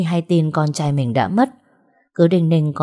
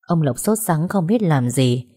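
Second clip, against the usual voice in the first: ordinary delivery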